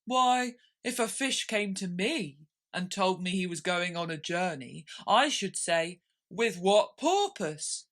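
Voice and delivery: slow, sorrowful creature voice; lofty, mournful turtle; Mock Turtle voice. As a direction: play the weepy Mock Turtle.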